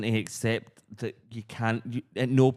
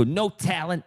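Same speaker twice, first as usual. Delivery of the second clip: higher sneering catchphrase; mock sneer; 7 semitones higher, slower than usual. Quoting their catchphrase to listeners, mock sneer. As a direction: toss it off like a snob. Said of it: "allows us to go".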